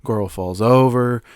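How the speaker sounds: usual speaking voice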